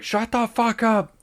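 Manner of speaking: high, slow retold yell at the machine; imitating a furious yell, pitched high and drawn out; mock furious yell. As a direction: belt it out like a man losing his mind. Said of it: "He's like".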